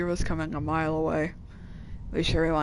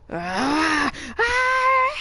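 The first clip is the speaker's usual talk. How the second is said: pained screams; 9 semitones higher, 3 dB louder than usual. Acted. Character being shot.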